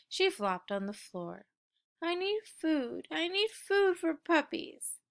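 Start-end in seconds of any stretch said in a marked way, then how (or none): 2.0-5.0 sad puppy. A narrator's reading. Puppy sulking on floor.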